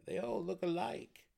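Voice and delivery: high-pitched gnome councilor. A narrator's reading. Second gnome agreeing with the warden, high gnome voice. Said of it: "said the other".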